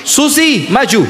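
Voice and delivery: high call of the name Susi. Pretend. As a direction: call her up to the front. Calling Susi forward. (memanggil dengan nada tinggi)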